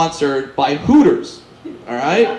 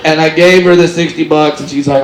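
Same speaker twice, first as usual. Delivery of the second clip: ordinary delivery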